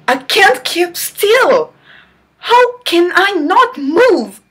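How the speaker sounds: normal talking voice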